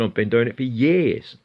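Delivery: ordinary delivery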